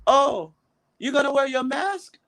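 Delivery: high-pitched imitation of Indonesian coworker; high, inquiring coworker voice. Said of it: "she came to me, said".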